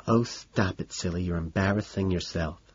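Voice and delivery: soft, lisped self-scolding; hushed lisp on "embarrassing yourself"; lisping to oneself. A narrator's reading. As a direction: scold yourself under your breath, lisping the s sounds. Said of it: "he lisps the following".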